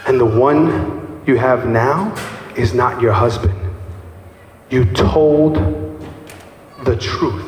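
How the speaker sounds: low, slow, weighty voice of Jesus; slow solemn Jesus. Acted. Preacher voices Jesus telling the woman, slow and solemn. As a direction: lean in and speak each word like a verdict.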